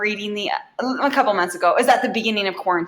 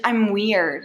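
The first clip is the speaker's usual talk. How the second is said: ordinary talk, nothing marked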